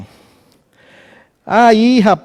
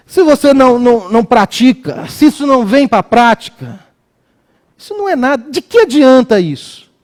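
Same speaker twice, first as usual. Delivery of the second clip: high, insistent preacher's challenge; impassioned preaching; 6 semitones higher than usual. Real, not acted. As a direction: challenge the congregation with raised pitch. Preaches on practising faith.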